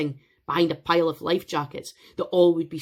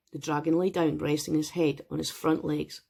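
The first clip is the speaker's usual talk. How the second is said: ordinary delivery, nothing marked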